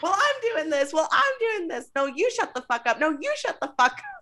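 high, sing-song mocking squabble; mocking the screaming match, high-pitched; high mock argument. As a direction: parrot the fight in a squeaky voice.

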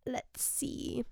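a breathy half-voiced aside; whispered thinking aside. Real, not acted. Pausing to check dates, whispered thinking aside. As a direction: whisper it to yourself.

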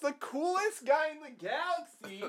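high-pitched comic protest; mock exasperation. Fan protesting an over-explained name, mock exasperated. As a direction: plead in a very high, indignant mock whine.

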